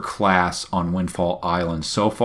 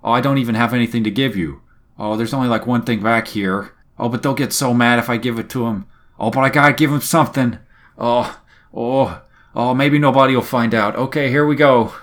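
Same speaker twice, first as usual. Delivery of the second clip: higher, fast, nervous dithering; flustered Nico; 3 semitones higher than usual. Retold or imitated. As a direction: talk yourself into breaking the rules, rushed. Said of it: "Nico's like".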